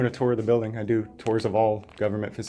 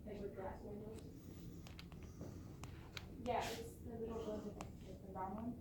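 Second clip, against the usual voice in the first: through glass; 10 semitones higher, 26 dB quieter than usual. Real, not acted. Clerk speaking from behind glass.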